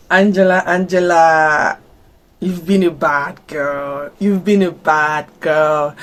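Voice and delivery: slow, drawn-out playful reproach; mock scolding. Vlogger addressing the character Angela, mock scolding.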